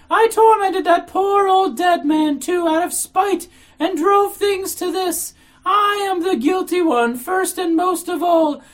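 very high, loud, drawn-out wail; heartrending howl. A narrator's reading. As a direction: howl it out slowly, all in tears. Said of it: "she cried in a heartrending howl, all in tears".

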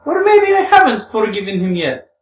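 high, loud character voice with mild menace; somehow menacing. Acted. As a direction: raise the voice into a teasing threat. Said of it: "somehow menacingly".

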